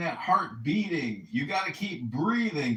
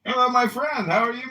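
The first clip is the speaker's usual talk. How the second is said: excited hello; 9 semitones higher, 8 dB louder, faster than usual. Real, not acted. Warm loud greeting on arrival.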